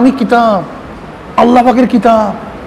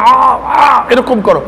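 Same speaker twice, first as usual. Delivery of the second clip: demo ain sound; much slower than usual. Shown as a demonstration. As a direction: belt out the throat sound. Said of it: "আইনকে উচ্চারণ করার জন্য".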